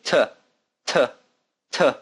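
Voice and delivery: T with a hard puff of air; forceful breathy T. Shown as a demonstration. Teacher showing how some people say it, puffed T. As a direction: spit the breath out on each T. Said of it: "like they're spitting their breath out".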